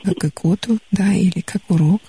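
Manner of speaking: usual speaking voice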